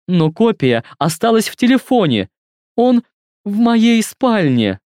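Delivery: put-on high tearful girl, broken phrases; halting, tearful. A narrator's reading. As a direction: stumble through it near tears. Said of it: "сбивчиво говорит, постоянно прерываясь".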